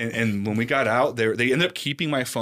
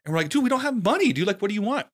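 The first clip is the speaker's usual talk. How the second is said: high-pitched pleading, retold; retold bewildered plea; 11 semitones higher than usual. Kid pleading with captors over money, high bewildered plea. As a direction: plead in a raised, incredulous voice. Retelling their pleas to captors.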